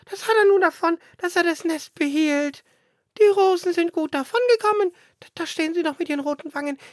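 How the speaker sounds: very high squeaky bird voice, tearful near the end; grumbling sparrow. Acted. Sparrow scolds the roses by the burnt house, high squeaky voice. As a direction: chatter like a sulky little sparrow.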